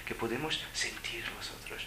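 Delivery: hushed, breathy whisper. Real, not acted. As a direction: lean in and whisper it. Intimate point about spirituality.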